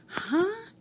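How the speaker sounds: loud, high-pitched "huh?"; puzzled huh. Retold or imitated. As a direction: call back as if you didn't catch it. Mishearing her mother from the stage.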